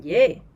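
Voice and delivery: Je said loud and punchy; loud question opener Je; stressed Je. Shown as a demonstration. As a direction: hit Je hard to flag the question. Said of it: "to emphasize that you're asking a question".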